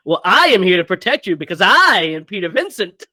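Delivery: boasting line broken by laughter; laughing through it. Retold or imitated. Co-host quoting Peter Vincent, laughing through it. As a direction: laugh while playing the vain hero.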